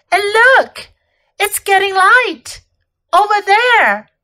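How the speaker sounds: high, loud, eager calling of attention; acted excitement, pitched well up; excited discovery. A narrator's reading. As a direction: point it out excitedly. Susan points out the light.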